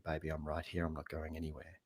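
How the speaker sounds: soft, low reassurance; hushed, steady comfort; quietly. Acted. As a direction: reassure a partner very gently and quietly. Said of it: "go and sit there quietly".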